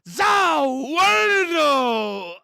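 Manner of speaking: drawn-out shout of shock; excited shout. Real, not acted. Viewer shouting at the screen in shock, stretched yell. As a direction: yell it out in disbelief, slow. Reacting to anime reveal.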